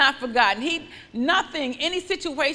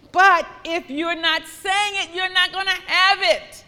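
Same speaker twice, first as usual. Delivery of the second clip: emphatic; 7 semitones higher, 6 dB louder than usual. Acted. Preacher building emphasis.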